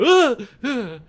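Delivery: high, loud mock screams jolting awake; hammed-up startled yells on waking. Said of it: "I suddenly wake up and go".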